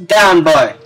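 usual speaking voice

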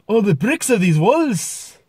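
high, slow Scottish lilt; Scottish accent. Shown as a demonstration. Vlogger retaking his impression line, Scottish accent. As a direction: swing the pitch up and down in Scottish. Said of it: "obviously Scotland".

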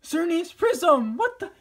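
high, loud, excited gasps. Real, not acted. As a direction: shout with delight at the card. Pulling a prism card.

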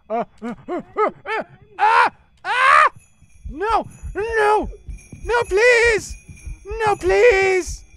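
high, loud panicked screams; terrified screaming. Real, not acted. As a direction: scream and beg for your life. Caught by monster, screaming.

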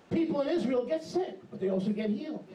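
usual speaking voice

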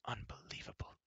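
whispered disbelief; prince marvelling. A narrator's reading. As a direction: breathe it out in awe. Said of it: "said Run".

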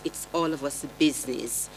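ordinary delivery

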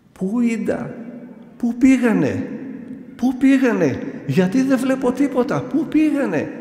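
high, wailing cries, rising as they go; howling. Retold or imitated. Possessed man howling that the sins are gone, high wailing. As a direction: howl it out in bewildered panic. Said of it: "να ουρλιάζει".

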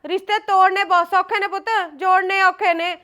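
loud, insistent motherly lecture; stern scolding. Acted. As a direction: scold your son firmly. Mother scolds her stubborn son.

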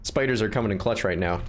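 ordinary talk

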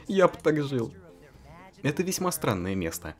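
ordinary delivery

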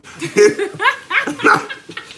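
a long high laugh; high burst of laughter. Real, not acted. Laughs at the dead bird.

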